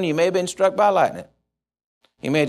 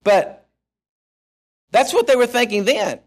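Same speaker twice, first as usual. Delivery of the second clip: ordinary delivery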